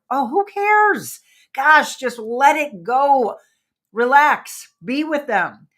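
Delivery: loud, slow, exasperated who cares; exasperated brush-off. Retold or imitated. Exasperated friend brushing off a nitpick, loud and slow. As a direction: wave off the nitpicker, fed up.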